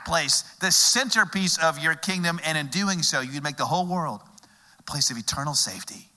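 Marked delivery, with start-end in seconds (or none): none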